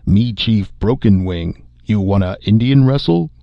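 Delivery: hamming up a fake chief act for the girl; drunken mock Indian chief. Drunk spacer teasing the housewife in a mock chief voice.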